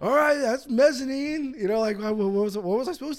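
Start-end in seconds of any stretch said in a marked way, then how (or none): 0.0-1.5 slow, hammed-up club comic; mock comedian warming up; stand-up comic. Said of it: "stand-up comedy routine".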